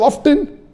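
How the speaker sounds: "often" with the T pronounced; sounded T. Shown as a demonstration. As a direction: say "often" as OFF-ten. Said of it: "T is not silent".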